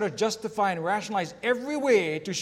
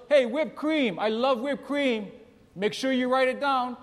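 husband hollering, higher and louder; retold yell; 7 semitones higher, 3 dB louder than usual. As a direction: call out from the chair, playful mock yell. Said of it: "he yells out".

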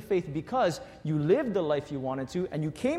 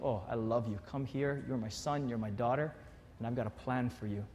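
soft, low, tender promise; gently, like a loving father; tender Jesus; 6 semitones lower, 8 dB quieter than usual. Retold or imitated.

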